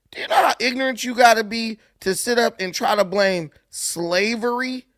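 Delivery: usual speaking voice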